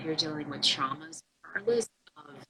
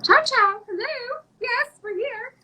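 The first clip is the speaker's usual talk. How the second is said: high, sing-song play-phone hello; answering a toy phone for a child; playful pretend phone voice; 4 dB louder, slower than usual. Playing pretend phone with nephew.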